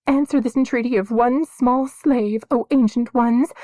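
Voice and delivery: high, loud desperate plea; girl crying her prayer to the sky. A narrator's reading. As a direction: cry it up to the sky. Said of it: "she cried into the thinning air".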